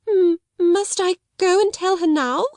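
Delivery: very high, stammering plea; panting dismay. A narrator's reading. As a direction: falter anxiously at an unwelcome order. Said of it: "panted Miss Amelia".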